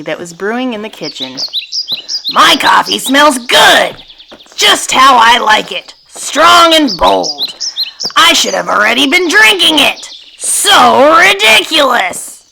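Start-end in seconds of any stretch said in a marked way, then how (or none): 2.3-12.4 high, emphatic storybook yelling; drawn-out, exasperated cartoon outburst; angry yell. A narrator's reading. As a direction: yell it out as a grumpy goose. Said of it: "Grumpy Goose yelled angrily".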